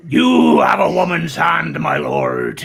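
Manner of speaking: slow, booming, hammed-up sea captain; Tom Baker. Host imitating a sitcom captain, slow booming West Country. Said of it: "Tom Baker was in Blackadder Two".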